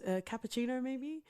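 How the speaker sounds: ordinary talk, nothing marked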